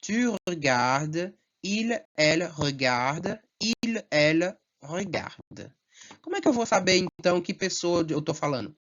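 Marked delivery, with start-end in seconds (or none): 0.0-5.7 tu regardes and il, elle regarde said slowly, every ending silent; teacher's slow model of the verb forms; slow French conjugation demo. Shown as a demonstration. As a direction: drill the conjugation slowly for the class. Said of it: "É a mesma pronúncia".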